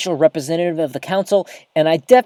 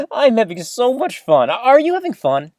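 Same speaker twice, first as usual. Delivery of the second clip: laughing, higher and a bit louder; radio host breaking into giggles mid-broadcast, delighted; giddy laugh; 9 semitones higher, 4 dB louder than usual. Real, not acted. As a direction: bubble over with real fun on air.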